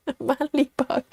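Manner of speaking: soft giggle through the words; quiet laughing repeat. Real, not acted. Still laughing at the joke.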